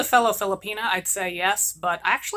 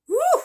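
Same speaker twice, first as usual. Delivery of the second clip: a far higher, loud whew; stink reaction; 5 dB louder than usual. Acted. Mother smelling the pineapple's diaper, loud whew.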